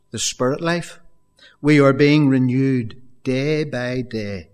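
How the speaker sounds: solemn Bible reading; scripture reading. Acted. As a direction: read the verses with reverence. Reads scripture passage.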